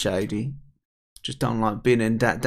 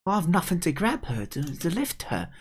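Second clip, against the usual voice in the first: put-on worried young character; 7 semitones higher than usual. Kid frets about rescue.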